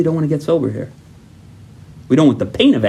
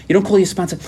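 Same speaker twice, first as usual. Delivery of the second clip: normal talking voice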